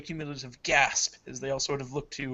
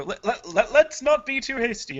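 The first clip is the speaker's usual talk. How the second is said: stammering, high, hurried-to-placate plea; flustered mayor; 9 semitones higher, 8 dB louder than usual. Acted. Mayor facing a musket calming everyone, high nervous stammer. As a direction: stumble on the first word and pitch it up nervously.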